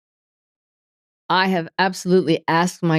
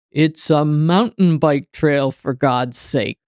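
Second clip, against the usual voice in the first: booming voice; slower than usual. Retold or imitated. Booming voice answering the irritated mountain biker, slow and booming. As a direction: drop down and boom it out slowly. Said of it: "I hear this booming voice say".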